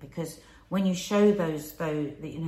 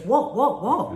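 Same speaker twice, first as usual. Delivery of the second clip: startled what; 4 semitones higher, 8 dB louder, slower than usual. Retold or imitated. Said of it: "the other human being goes".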